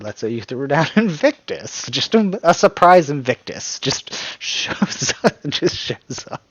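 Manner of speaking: real giggles taking over, breath running out; cracking up. Real, not acted. Cracking up imagining surprise Invictus.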